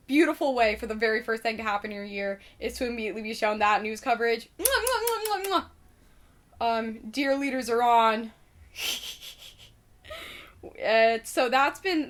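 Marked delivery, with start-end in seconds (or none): 4.6-6.8 high smacking air kisses; blown kisses. Pretend. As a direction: blow him a string of kisses. Blowing kisses to the mayor.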